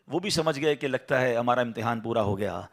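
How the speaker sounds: ordinary talk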